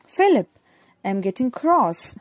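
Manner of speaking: "Philip" called out high and loud, then "I'm getting cross"; exclaimed. Shown as a demonstration. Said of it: "you have to read it astonishingly".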